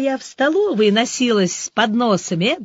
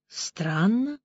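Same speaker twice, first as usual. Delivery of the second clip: softly drawn-out "Странно"; 7 dB quieter than usual. A narrator's reading. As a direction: stretch the word, puzzled. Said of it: "протянула Ксюша".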